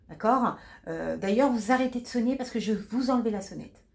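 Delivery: curt, annoyed nurse; impatient nurse. Acted. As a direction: brush off the patient's call. Nurse scolds patient in acted scene.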